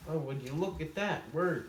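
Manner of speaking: normal talking voice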